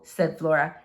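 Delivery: ordinary delivery, nothing marked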